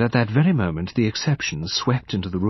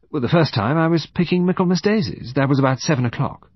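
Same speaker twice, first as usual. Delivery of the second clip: higher-pitched, quick account; young woman explaining; 6 semitones higher than usual. Acted. Woman explaining herself to detective, readily.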